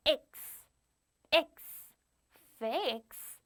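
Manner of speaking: X as "iks", then blended into "fix"; phonics blending. Shown as a demonstration. Teacher explaining why fix sounds so, phonics blending. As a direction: sound out the ending "X", then the whole word "fix". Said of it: "이 발음이 나기 때문이죠".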